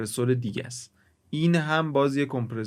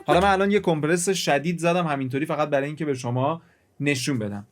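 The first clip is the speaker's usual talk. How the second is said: normal talking voice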